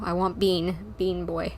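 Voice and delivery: normal talking voice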